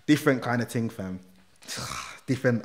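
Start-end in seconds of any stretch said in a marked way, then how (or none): 1.7-2.2 deep unvoiced sigh; emotional sigh. Real, not acted. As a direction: let out a moved sigh.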